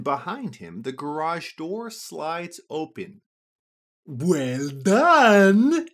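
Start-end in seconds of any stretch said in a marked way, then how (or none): 4.0-5.9 booming, slow sphinx voice; a grand, pleased riddle-master. A narrator's reading. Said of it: "he says".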